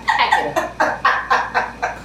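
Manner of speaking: laughing mock dismissal. Mock agent telling her to quit.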